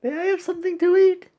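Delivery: quick, high-pitched asker; high pleading character voice. Acted. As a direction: plead fast in a squeaky cartoon voice.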